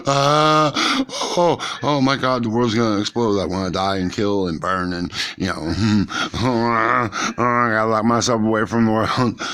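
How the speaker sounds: high, loud panicky gasps and babble; mock panic attack. Telling friends how a panic attack would hit, in high mock panic. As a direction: ham up a sudden freak-out, voice shooting up. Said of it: "all of a sudden I'm gonna be like".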